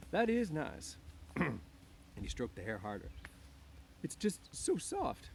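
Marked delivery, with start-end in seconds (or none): 0.1-1.0 put-on high, louder childlike delight. A narrator's reading. Lenny stroking her hair, delighted. 4.0-5.3 high, slow, drawn-out wonder; wondering delight. A narrator's reading. Lenny delighted by soft hair.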